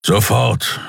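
gruff, curt command from a big beast; firm grumbled command; grumbled order. A narrator's reading. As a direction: order it with a growl, no stammer. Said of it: "brummte er".

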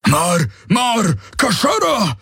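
hohe, gebrüllte Alarmrufe; Brüllen. A narrator's reading. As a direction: fremde Worte dringlich herausbrüllen. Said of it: "brüllte er sie an". (hoch und angespannt gebrüllt)

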